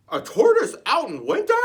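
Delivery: high, loud barking voice; dog barking at Shelley; barked. Acted. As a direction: bark the question like a dog. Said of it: "barked the dog".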